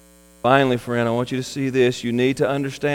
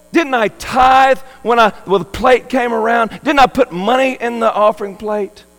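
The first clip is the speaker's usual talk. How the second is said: mock pleading excuses; 8 semitones higher, 5 dB louder than usual. Churchgoers plead their excuses.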